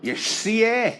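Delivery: hammed-up meathead boasting about a girl; nightclub lad. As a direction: play the cocky bloke at the bar.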